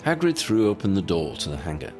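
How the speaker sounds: ordinary delivery, nothing marked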